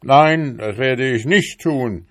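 fed-up beetle husband yells at his nagging wife; shouting. A narrator's reading. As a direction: finally shout back at her. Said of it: "schrie Balduin Brumsel".

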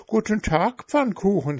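high, quick little hare. A narrator's reading. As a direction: chirp it like a hare. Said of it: "sagte der Hase".

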